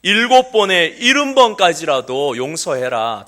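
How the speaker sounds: Jesus firmly telling Peter to forgive; Jesus commanding. Retold or imitated. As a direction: speak as Jesus with weighty authority. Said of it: "예수님께서는 이야기하십니다".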